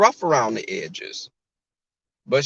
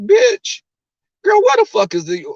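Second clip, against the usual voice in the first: high, loud disbelieving outburst; incredulous outburst; 14 semitones higher, 5 dB louder than usual. Retold or imitated. Snapping at a crying coworker in HR, high and loud. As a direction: blurt it out in disbelief. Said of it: "and you'll be like".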